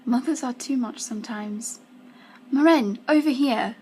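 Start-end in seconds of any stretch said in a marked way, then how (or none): 2.5-3.8 louder, higher friendly yell; calling out. A narrator's reading. Friend calling Maren across the party, raised voice. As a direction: call a friend over from a distance. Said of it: "I hear someone yell".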